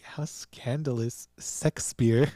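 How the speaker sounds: "Shakespeare" said as "Sexspeare"; mispronounced Shakespeare. Retold or imitated. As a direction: say "Shakespeare" with "sex" for the first syllable. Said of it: "Not Shakespeare".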